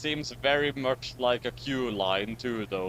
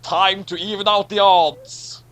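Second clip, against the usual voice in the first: dramatic threat; 8 semitones higher, 9 dB louder, slower than usual. Acted.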